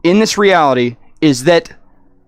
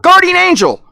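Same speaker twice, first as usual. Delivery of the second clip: bewildered call; 12 semitones higher, 3 dB louder than usual. Retold or imitated. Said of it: "so confused".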